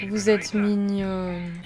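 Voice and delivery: slow, tender coo. Real, not acted. Streamer cooing over her two Sims, slow and tender. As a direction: gush over something cute.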